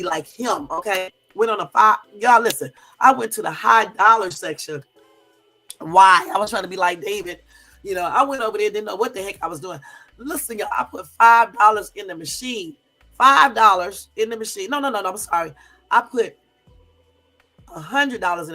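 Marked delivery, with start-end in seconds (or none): none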